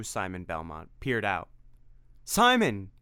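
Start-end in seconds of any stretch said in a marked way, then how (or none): 2.2-2.9 high loud cry of his name; desperate shout; cried out. Acted. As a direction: call out to your friend desperately. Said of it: "cried Tim".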